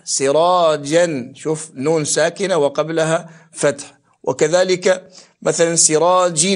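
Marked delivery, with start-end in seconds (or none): 0.0-1.3 سراجا drawn out slowly, ending "-an"; fatha tanween. Shown as a demonstration. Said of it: "يعني وقبلها فتح". 5.8-6.5 سراج ending in a sounded "-in"; kasra tanween. Shown as a demonstration. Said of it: "بالنسبة إلى تنوين الكسر".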